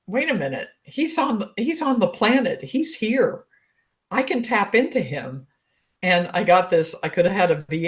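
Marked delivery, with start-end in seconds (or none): 0.1-5.5 much higher, a breathless rush of wonder; like it just dawned on you he's real; excited realization. Retold or imitated. Realizing her destined partner is out there, excited and higher.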